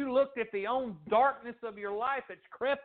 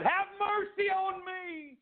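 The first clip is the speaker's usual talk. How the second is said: high drawn-out beggar's cry; long, high-pitched plea; 10 semitones higher, slower than usual. Retold or imitated. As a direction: call out slowly and high, pleading for mercy. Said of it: "He cried".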